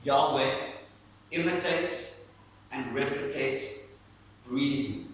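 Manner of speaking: "Yahweh imitates and replicates breathing" with pauses between the words; spaced out, word by word; slow, deliberate repeat. Shown as a demonstration. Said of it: "I'm gonna say that again".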